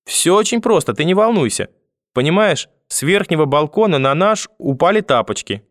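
put-on high-pitched, ingratiating child's explanation; boy placating grandmother. A narrator's reading. Grandson inventing a slipper story for grandmother, placating tone. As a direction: talk her down in a high voice.

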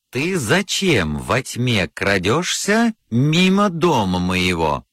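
usual speaking voice

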